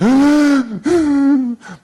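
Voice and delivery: put-on high loud gasping sob of a little girl; sobbing gasp. Sobbing granddaughter runs to grandpa, gasping. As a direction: gasp in like a crying child.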